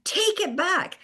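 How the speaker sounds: a hint of an angry order; mock demand. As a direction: imitate him snapping back. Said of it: "he reacted".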